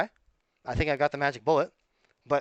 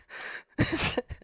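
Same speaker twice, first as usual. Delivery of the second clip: breathy laugh; 13 semitones higher than usual. Real, not acted. Laughs after his own demand.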